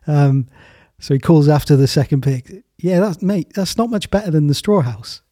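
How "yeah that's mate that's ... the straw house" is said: raised, teasing call; higher cheeky call. Acted. Third pig calls after brother.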